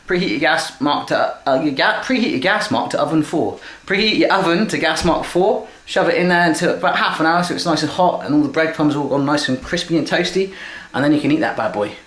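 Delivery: ordinary talk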